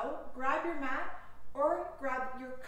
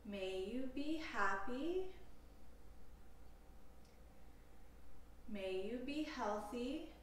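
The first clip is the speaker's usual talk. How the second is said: slow, calm meditation voice with long pauses; guided meditation; 3 semitones lower, 4 dB quieter than usual. Acted. Yoga teacher leading listeners through a compassion meditation, calm guided delivery. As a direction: guide them unhurriedly into stillness, leaving space between phrases.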